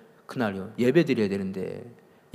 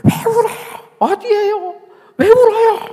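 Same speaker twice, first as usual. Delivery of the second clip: very high, loud, frantic rapid-fire questions; urgent questioning; 11 dB louder than usual. Retold or imitated. Impatient pastor asking a sobbing woman on the phone, urgent questioning. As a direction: fire off alarmed questions at a shrieking pitch. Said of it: "내가 좀 성질이 급하거든요".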